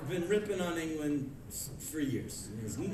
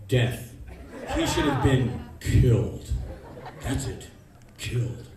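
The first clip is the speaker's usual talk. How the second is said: low, slow brooding killer voice; murderous grumble; 7 semitones lower, 5 dB louder than usual. Pretend. Comic plotting revenge, low menacing mutter. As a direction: growl it like a lunatic.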